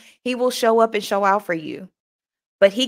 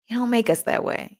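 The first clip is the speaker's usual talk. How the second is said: usual speaking voice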